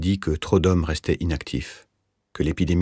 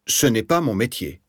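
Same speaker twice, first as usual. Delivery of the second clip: higher-pitched, offended refusal; 6 semitones higher than usual. A narrator's reading. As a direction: refuse as if affronted. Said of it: "d'un air offensé". (d'un ton offensé)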